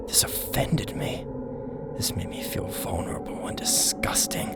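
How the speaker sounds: hushed, haunted whisper. A narrator's reading. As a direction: breathe it out in dread. Hushed horror narration.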